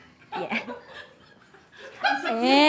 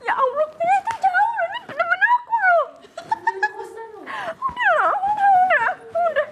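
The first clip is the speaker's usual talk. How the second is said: much higher, pleading to calm her; squeaky comforting coo, much higher; high soothing voice. Acted. Friend soothing the crying girl, much higher.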